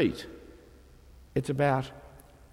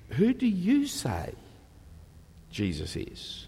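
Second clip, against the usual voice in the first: slow, with a higher start on "you" and a soft fall after; stress on you; 4 semitones higher, 3 dB quieter, slower than usual. Shown as a demonstration. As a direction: press the listener with "you". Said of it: "asking you about you".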